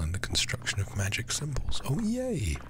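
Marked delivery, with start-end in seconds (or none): none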